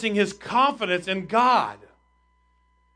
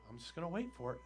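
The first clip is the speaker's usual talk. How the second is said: very soft, quiet patience; calm composure; 18 dB quieter, much faster than usual. Retold or imitated. Psalmist resolving to wait on God, calm composure. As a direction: drop to a hushed, composed trust. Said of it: "a calm composure here".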